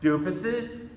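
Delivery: high, loud furious rant; exasperated yelling at the township; angry outburst. Retold or imitated. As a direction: let it burst out in indignation. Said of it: "And I'm like".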